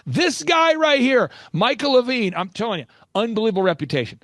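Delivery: loud, high-pitched proclamation; big showman's build-up; emphatic hype. Real, not acted. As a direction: announce him loudly, stretching the words. Hyping up his mentor emphatically.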